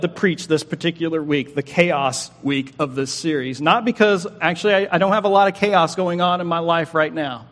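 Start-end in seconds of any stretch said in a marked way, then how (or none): none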